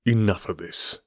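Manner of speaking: understated, cool firmness; cold command. A narrator's reading. Detective covering the old man, mildly cold command. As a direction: order it coolly. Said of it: "said my friend coldly".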